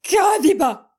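loud, high yell; shout. A narrator's reading. Mohamed shouting at Amal, calling her a liar. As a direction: it bursts out of him. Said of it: "صرخ فيها رغما عنه".

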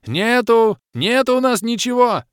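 high, loud frantic scream. A narrator's reading. Bom screaming to guard the bottles. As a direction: scream the denial in panic. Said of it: "завопил Бом".

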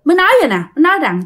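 ordinary delivery, nothing marked

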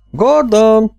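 high, loud, plaintive shout. A narrator's reading. Emerson calls Gordon into the dark jungle, pitiful shout. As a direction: call out the name, close to despair. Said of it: "kiáltotta még siralmasabban".

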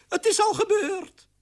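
very high-pitched character voice; old aunt figure. Acted. Aunt Oena welcomes the queen, high character voice. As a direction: pipe it up in a high put-on voice.

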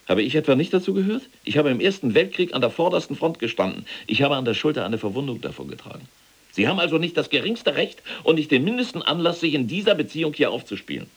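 hoch und erregt protestierend; gekränkt und erregt zurückschlagen; empört. Acted. General verteidigt sich empört. (empörter Protest, höher)

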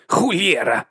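put-on high, falling oath from an old tramp; old tramp's exclamation. A narrator's reading. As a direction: exclaim it high, then let it fall.

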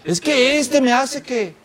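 quejido agudo y lento; quéjate señalando al culpable, alargando; culpando a otros. Acted. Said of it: "le echa la culpa a los demás". (queja acusadora fingida)

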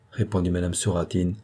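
plain reading voice

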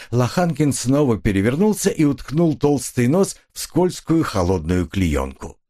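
usual speaking voice

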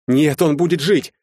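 frantic, forceful insistence; desperate defiance. A narrator's reading. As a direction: shout against Death, refusing to accept it.

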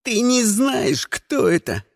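slow, strained warning; snarled. A narrator's reading. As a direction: force it out in desperate anger. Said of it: "зарычал на неё Сиприт".

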